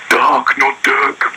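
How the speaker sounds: "Dark, not Dirk" high and very slow; "Dark" drawn out high, then "not Dirk"; Dark Lord voice, "Dark" corrected. Retold or imitated. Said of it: "storyline through the three books".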